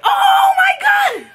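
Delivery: very high screamed astonishment; shocked squeal. Retold or imitated. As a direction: squeal as if you just recognized a star. Realizes who the actor is.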